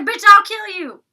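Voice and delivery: high, loud mock yell; hammed-up shouted threat from the angry housemate. Mocking Winter's threat.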